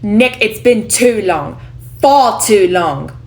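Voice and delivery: loud, slow, British-leaning stage voice; attempted New York accent coming out British; British-sounding accent. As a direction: project it slowly, slipping into British. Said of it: "That sounded more like a British accent".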